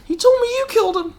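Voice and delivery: very high whiny voice; hammed-up high Luke voice; mock Luke. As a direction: squeak it like a whiny farm boy. Mocking Luke's line.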